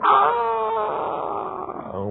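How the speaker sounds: high cartoon yell flying up; cartoon scream. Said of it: "dia teriak".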